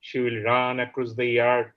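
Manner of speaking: declaimed openings, settling into measured verse; poetry recitation. Acted. Poet reading the translated version to the audience, poetry recitation. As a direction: recite the verse line by line with deliberate pauses.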